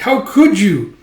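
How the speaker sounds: high, loud reproach; acted indignation; appalled reproach. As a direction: cry out as a wounded little elf. Elf appalled at Guts.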